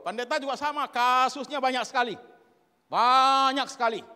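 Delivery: very loud, high outburst; full-force high-pitched pulpit yell; emphatic preacher's shout. Real, not acted. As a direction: shout it, stretching the last repeat.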